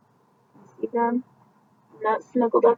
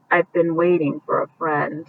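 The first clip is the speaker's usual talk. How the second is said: a touch of sadness, a little lower and softer; sad; 5 semitones lower, 4 dB quieter than usual. Acted. Farfalina telling the goose she waits, softly sad. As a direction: let her sound a bit forlorn. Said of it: "she said sadly".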